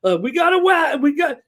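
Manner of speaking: ordinary delivery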